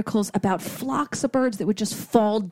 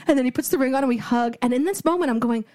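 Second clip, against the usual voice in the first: ordinary talk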